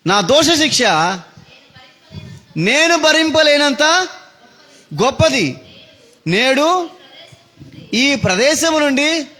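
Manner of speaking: high, slow chanted lament; lamenting scripture read. Acted. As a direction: mourn it out like Cain's cry. Reads Cain's lament with music. (ఎత్తైన స్వరంలో విలాపంగా చదవడం)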